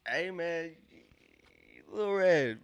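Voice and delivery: normal talking voice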